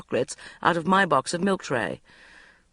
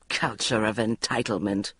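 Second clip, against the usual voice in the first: growled; 6 semitones lower, 3 dB quieter than usual. A narrator's reading. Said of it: "growled Sharon".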